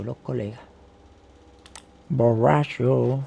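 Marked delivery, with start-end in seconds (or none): 2.1-3.2 mock drunk slur. Repeats word as if drunk.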